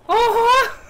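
high, loud delighted squeal into laughter. Real, not acted. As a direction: gush over something cute. Delighted by dancing ghosts.

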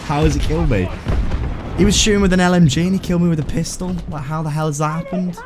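can't believe he died to a pistol; incredulous disbelief. Real, not acted.

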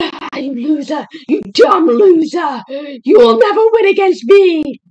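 mocking victory yell; taunting shout. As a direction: yell in triumph at the foe. Taunting the enemy in game.

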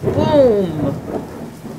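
a slow booming thunder line. A narrator's reading. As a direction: boom it out like thunder. Thunder in story.

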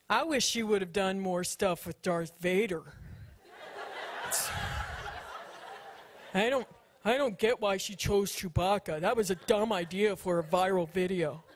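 low, put-on grumbling commenter voice; mocking online critics. Complaining about her viral Chewbacca video, mock grumbling critic.

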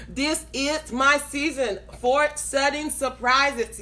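slow, spaced-out proclamation; slow declaration. Real, not acted. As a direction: dictate it slowly for people to type. Dictating a declaration to type.

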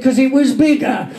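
high, badly imitated punchline voice; Dave Lee. Friend quoting the silly answer, poor impression. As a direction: mimic your mate's accent, pitched well up. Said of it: "That impression was so bad".

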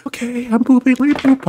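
very high squeaky voice. Pretend. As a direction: squeak it in a tiny voice.

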